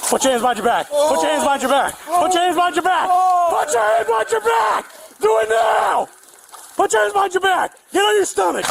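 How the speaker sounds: firm order rising to a yell; commands building to a shout. Real, not acted. Officer orders suspect at gunpoint.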